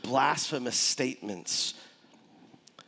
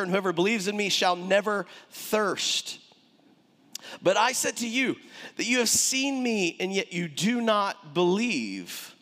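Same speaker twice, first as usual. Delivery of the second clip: raised, ringing preacher's reading of scripture; 6 semitones higher than usual. Acted. Preacher reads Jesus's words to the church, raised proclaiming voice. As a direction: proclaim the words over the congregation.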